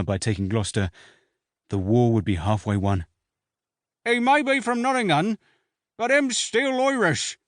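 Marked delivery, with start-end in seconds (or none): 4.0-7.4 high, slow rustic voice calling out; bawling heckler. A narrator's reading. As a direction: yell it back like a cheeky country soldier. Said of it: "a well-concealed wag bawled back".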